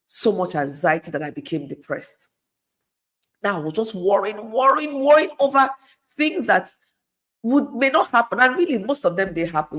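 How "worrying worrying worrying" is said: "worrying" repeated, each higher and louder; building, insistent repetition of "worrying"; mounting worry. Retold or imitated. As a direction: climb in pitch and volume on each "worrying". Retelling her escalating, repeated worry.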